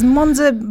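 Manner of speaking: Monza rolled out with relish, falling tune; savouring the word. Shown as a demonstration. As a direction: linger lovingly on Monza. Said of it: "it's a great word. I think I might just say it".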